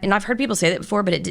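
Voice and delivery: usual speaking voice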